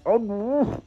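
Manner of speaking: ordinary talk, nothing marked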